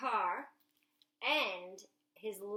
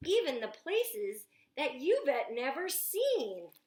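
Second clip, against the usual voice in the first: put-on louder, showing off the kitchen to the kids; eager storytime reading; 3 semitones higher, 5 dB louder, slower than usual. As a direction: sell the gadget like an excited boy. Reads Jack's lines aloud.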